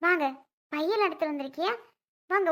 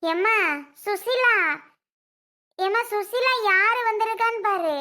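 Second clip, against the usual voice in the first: higher, louder summons; calling out; 4 semitones higher, 4 dB louder than usual. Acted. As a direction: call someone from another room. Summons Susila to see visitors.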